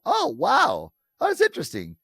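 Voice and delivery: high, loud, pleasantly amazed; delighted surprise. Retold or imitated. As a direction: gush with real interest. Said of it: "We go".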